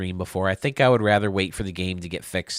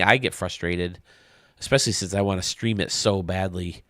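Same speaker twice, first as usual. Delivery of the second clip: usual speaking voice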